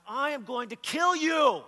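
slow, loud, high-pitched yell; enraged threat. Retold or imitated. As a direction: bellow each word at your brother. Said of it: "just this rage starts coming inside of me".

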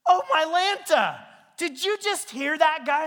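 put-on a young guy who can't believe his ears; shocked outrage. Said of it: "he's like".

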